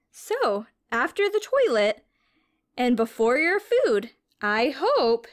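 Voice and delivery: bright, sing-song storybook read-aloud; reading to children. A narrator's reading. Teacher reading a science picture book to children, storybook voice.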